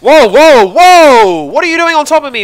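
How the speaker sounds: loud high startled whoas; startled shouting. Real, not acted. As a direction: shout in alarm, pitch way up. Teammate suddenly lands on him.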